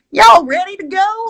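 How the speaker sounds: put-on high, loud hyper "y'all" twang accent; mock guide accent. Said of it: "really, the friggin' accent".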